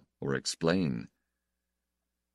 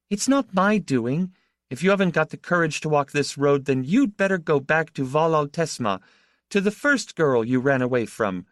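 taunting; 7 semitones higher, 3 dB louder than usual. A narrator's reading. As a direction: drive him off with scorn. Laura taunts him in a quarrel.

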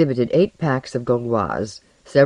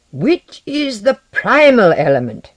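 high, slow, emphatic demand; fierce shout; 8 semitones higher, 7 dB louder than usual. A narrator's reading. Alchemist demanding answers at knifepoint, fierce shout. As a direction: raise the voice and stretch the question out fiercely. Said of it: "Lenoir shouted, his eyes fiery".

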